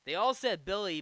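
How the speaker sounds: ordinary talk, nothing marked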